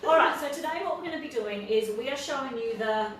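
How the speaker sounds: normal talking voice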